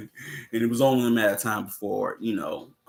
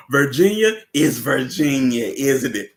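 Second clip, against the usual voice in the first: louder, proud answer; Carter's reply; 3 semitones higher, 6 dB louder than usual. Retold or imitated. Carter answering the villain, louder and proud. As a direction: say it like a proud Southerner.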